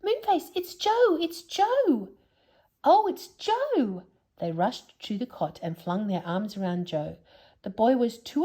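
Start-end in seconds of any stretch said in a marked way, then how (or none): 0.0-4.0 much higher excited call; yelled loudly. Acted. Sisters recognising Joe, excited high yell. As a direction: cry out with delighted relief. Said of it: "they yelled loudly".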